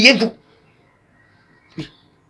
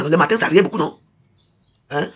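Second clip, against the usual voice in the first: ordinary talk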